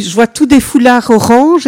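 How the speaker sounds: ordinary talk, nothing marked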